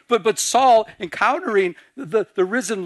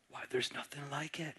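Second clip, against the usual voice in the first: very soft, low and reverent; hushed wonder; 5 semitones lower, 20 dB quieter than usual. Retold or imitated. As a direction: murmur it low, savouring the memory. Imagined listener cherishing hearing God.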